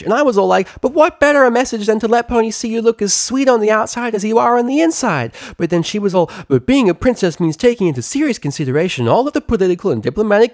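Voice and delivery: very high, fast, bouncy chatterbox; high giddy pony gabbing; bubbly pony chatter. Acted. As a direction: gush it out without breathing. Pinkie Pie voice held.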